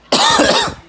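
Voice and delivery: harsh hacking cough; sharp cough that hurts; painful cough. Real, not acted. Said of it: "Oh, that hurts".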